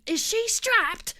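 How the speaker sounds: slow, high imitation of a British steward; a hammed-up British accent, drawn out. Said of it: "in his British accent".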